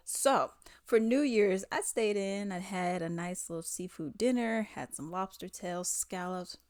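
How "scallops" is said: ordinary delivery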